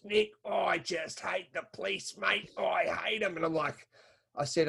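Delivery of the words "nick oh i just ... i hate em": lightly voiced fed-up mate, a little higher; a hint of the fish guy grumbling about police; retelling a mate's rant in a mildly higher voice; his mate's way of talking. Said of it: "this is how he talks".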